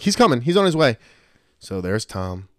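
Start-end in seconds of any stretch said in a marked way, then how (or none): 0.0-1.0 high, loud retold plea to the gate; acted urgent shout; urgent calling out. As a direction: insist loudly, imitating anxious travelers stalling. Acts out pleading with gate agent.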